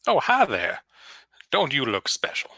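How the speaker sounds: high, coy Southern vampire flattery; Lindsey Graham voice. Acted. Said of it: "Southern Belle sort of character".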